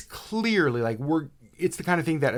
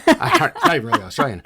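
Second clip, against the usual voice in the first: laughing; 14 semitones higher, 9 dB louder, faster than usual. Real, not acted. Laughing at own bad accent.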